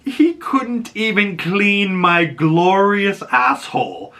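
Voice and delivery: imitating Mephisto's grand sneer; mock Mephisto voice. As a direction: lean into glorious like a smug devil. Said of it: "the way he said glorious".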